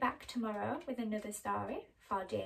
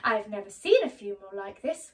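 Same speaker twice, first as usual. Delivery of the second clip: gravedigger; 9 dB louder, faster than usual. Acted. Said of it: "one of them said".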